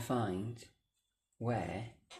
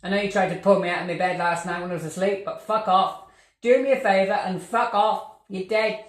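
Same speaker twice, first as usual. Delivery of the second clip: angry shouting; 4 semitones higher, 4 dB louder than usual. Real, not acted. Telling the ghost to leave.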